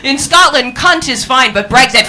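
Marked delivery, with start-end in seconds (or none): none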